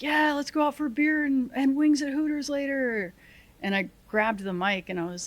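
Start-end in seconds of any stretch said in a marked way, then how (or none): 0.0-3.1 mock eager soldier voice, pitched higher; eager buddy. Soldier on the radio hyping up Hooters, mock eager higher voice. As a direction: play the hyped-up buddy suggesting beer. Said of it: "one of the guys was like".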